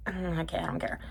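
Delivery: quiet, unimpressed dismissal; soft, bored brush-off; jaded indifference. Acted. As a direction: shrug it off under your voice. Acts jaded viewer's reaction.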